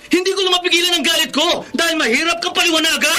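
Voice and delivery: high, heated outburst; angry outburst. Acted. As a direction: blurt out your anger. Husband admits his anger. (galit na pagsabog)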